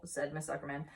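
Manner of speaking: ordinary delivery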